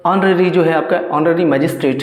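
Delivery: ordinary talk, nothing marked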